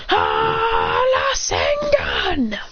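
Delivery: high, very slow strained rasengan cry; high breaking anime yell; crying attack shout. Acted. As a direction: strain and howl the move. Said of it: "Then he goes".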